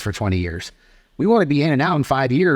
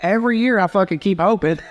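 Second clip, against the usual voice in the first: ordinary delivery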